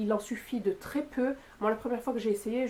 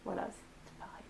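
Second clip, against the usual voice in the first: chuchoté; 3 semitones lower, 14 dB quieter than usual. Real, not acted. Aparté chuchoté après un rire.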